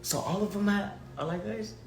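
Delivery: high, soft incredulous question; acted wide-eyed wonder, voice lifted; hushed disbelief. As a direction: ask it softly, hardly believing it. Acts out amazement at conference.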